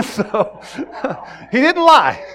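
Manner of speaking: bursting out loud through laughter; laughing. Real, not acted. Pastor cracking up at his own joke, loud and laughing.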